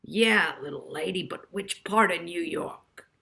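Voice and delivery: put-on a light Brooklyn accent, brisk; a hint of a Brooklyn cab attendant. A narrator's reading. Cab rank attendant asks Molly, Brooklyn accent. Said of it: "with a thick Brooklyn accent".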